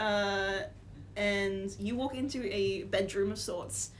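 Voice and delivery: ordinary talk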